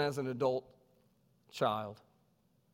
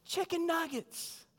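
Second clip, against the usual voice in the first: put-on much higher, slow childish chant; kids answering; slower than usual. Kids answering the teacher, high slow chant. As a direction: sing it out like a classroom of children. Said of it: "And the kids would say".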